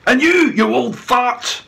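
loud, high, slow insult; angry insult. A narrator's reading. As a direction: spit the insult. Chief insults old man.

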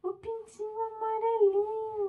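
high, loud delighted cry; excited reveal. Acted. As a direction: announce it like a big surprise. Big reveal of chick puppet. (revelação animada e alta)

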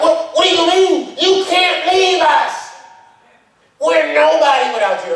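high, loud, pleading cry from Peter; panicked protest. Retold or imitated. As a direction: beg him not to leave, voice high and loud. Peter protests Jesus leaving.

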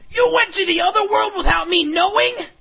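very high, loud outraged scream. A narrator's reading. Hero to his dragon who left without telling, screamed. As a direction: scream it in disbelief at full pitch. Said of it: "I screamed".

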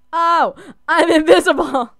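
high, loud delighted exclamation; excited discovery. Real, not acted. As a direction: shout it out, thrilled at the trick. Discovers the invisibility works.

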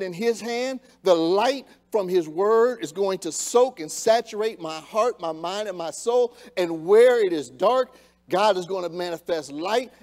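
impassioned sermon at full voice; fervent preaching. Acted. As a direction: preach it out with fire.

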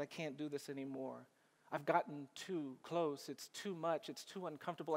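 low, soft, drained admission; defeated. Retold or imitated. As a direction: give up quietly, worn down by loss. Defeated after his client's execution.

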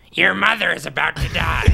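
high, loud squawk on grave news; mock Gottfried breaking sad news; Gilbert Gottfried voice. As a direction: deliver bad news in a comic screech. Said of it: "something serious where it's like".

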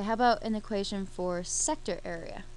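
ordinary talk, nothing marked

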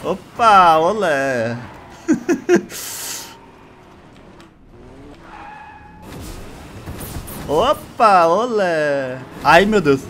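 excited yelps while dodging; being chased. Real, not acted. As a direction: cry out as trucks close in.

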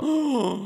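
high theatrical gasp; acted gasp. Gamer voices a startled game character, acted gasp. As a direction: suck in air in mock shock.

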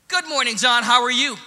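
loud, high, aggressively polite greeting; mad but sounding nice. Retold or imitated. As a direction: belt out niceness while seething. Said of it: "really mad, but you sound nice".

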